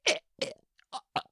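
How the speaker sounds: "え" and "あ" said while burping; burping the words. Shown as a demonstration. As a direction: talk through a burp. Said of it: "喋りながらゲップをする".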